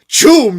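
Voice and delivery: loud, high exploding noise; comic-book sound effect. Acted. Reading a new onomatopoeia off the page, booming sound effect. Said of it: "it's a new onomatopoeia".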